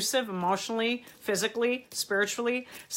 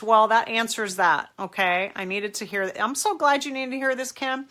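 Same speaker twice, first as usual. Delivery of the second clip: usual speaking voice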